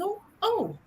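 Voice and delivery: high, hesitant "oh, oh" building to a loud high cry; from timid doubt swelling into a triumphant shout; doubtful then daring woman. Retold or imitated. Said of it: "who are thinking".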